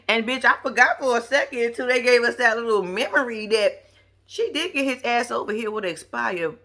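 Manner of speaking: ordinary talk, nothing marked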